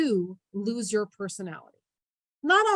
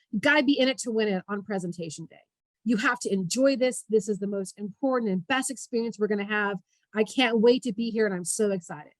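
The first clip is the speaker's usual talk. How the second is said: ordinary talk, nothing marked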